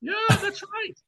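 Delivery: high excited yell of yes; a burst of delighted high agreement; laughing shout of agreement. Real, not acted. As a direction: shout your agreement as the joke lands. Joke lands, high shout of agreement.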